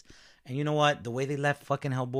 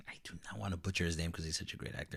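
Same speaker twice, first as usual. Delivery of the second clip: whispering; 4 semitones lower, 8 dB quieter, faster than usual. Real, not acted. Worried about pronouncing name.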